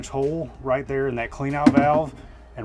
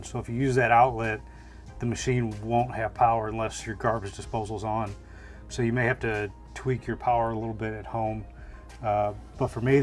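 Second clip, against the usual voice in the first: usual speaking voice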